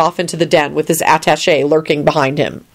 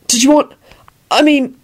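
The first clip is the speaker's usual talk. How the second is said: high, halting false starts; floundering; 9 semitones higher than usual. A narrator's reading. Neville attempts to offer Kingsley a drink, floundering. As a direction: trail off mid-offer, at a loss. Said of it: "no idea how to finish the sentence".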